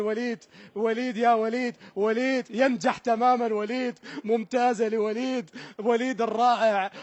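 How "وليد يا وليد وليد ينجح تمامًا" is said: ordinary talk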